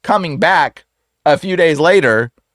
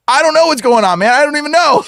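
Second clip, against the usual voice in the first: high, raised-voice helpless protest; flustered alarm; 10 semitones higher, 3 dB louder than usual. Retold or imitated. Helper answers the panicking man, flustered and alarmed. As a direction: plead ignorance in a mock panic. Acting out his own alarmed reply.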